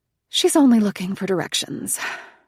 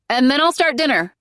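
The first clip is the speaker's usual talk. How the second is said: 1 calling down; 8 semitones higher, 7 dB louder than usual. Acted. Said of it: "I tell my mother".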